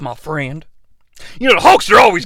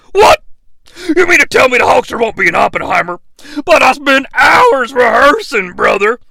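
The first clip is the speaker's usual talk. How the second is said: loud, higher outraged disbelief; shocked Hulk Hogan; 7 semitones higher, 4 dB louder than usual. Acted. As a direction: blurt it out stunned. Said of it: "Hulk Hogan".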